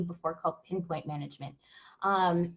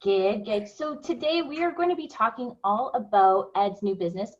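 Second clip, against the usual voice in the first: ordinary delivery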